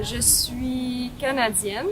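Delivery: ordinary delivery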